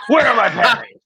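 hammed-up Batman demand. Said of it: "I was feeling the voice change".

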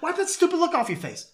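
high-pitched angry order; sudden high mock scolding; intensely angry. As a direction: imitate a movie character snapping angrily. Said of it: "intensely angry".

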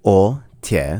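ordinary delivery

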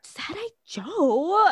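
high, drawn-out scandalized question; retold shock. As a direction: replay your shock, stretching the name slowly. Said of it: "And I was like".

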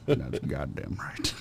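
slow low Morgan Freeman line; Morgan Freeman impression. Ending the Morgan Freeman impression, low and slow. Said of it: "I'll do it".

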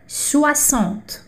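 soixante said slowly, swa-SANT, X as S; French soixante. Shown as a demonstration. Teacher modelling soixante slowly for the class to repeat. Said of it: "Repita comigo".

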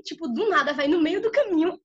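ordinary delivery

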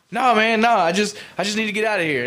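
high, loud agitated refusal; imitated freaking out. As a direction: refuse urgently like a panicked man. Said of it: "crying and fucking freaking the fuck out".

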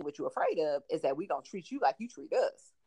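ordinary delivery

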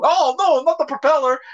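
high, loud hammed-up alarm; mock panic. Dreamer shaking in bed in the night, high mock-panicked cry. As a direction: cry out in pretend terror.

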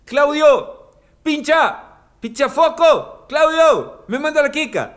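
high, loud calling out to the club owner; hammed-up high yell across the club; mock party shout. Fans calling to Claudio at the door, shouted high and loud. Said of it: "Van y dicen".

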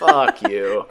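retold comeback breaking into laughter; amused, laughing mock insult; laughing retort. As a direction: toss it off through a laugh.

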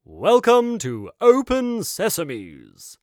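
slow, loud, showy welcome; grand shopkeeper. Acted. Shopkeeper grandly greeting the family into his shop. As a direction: roll it out like a showman.

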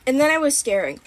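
usual speaking voice

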